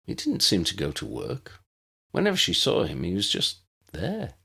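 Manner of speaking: usual speaking voice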